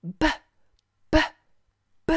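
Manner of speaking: "B" as a short burst of the lips, three times; popped B sound. Shown as a demonstration. Teacher shows child the sound, "B" popped from the lips. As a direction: pop each "B" like a tiny bubble bursting, pauses between. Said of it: "comme si tes lèvres faisaient une petite bulle qui éclate".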